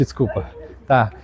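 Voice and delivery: normal talking voice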